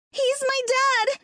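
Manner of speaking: put-on very high, drawn-out child's protest; crying out. A narrator's reading. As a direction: cry out slowly in a high boy's voice. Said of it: "Terry cried".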